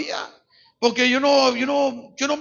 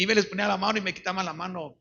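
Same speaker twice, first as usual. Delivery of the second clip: ordinary delivery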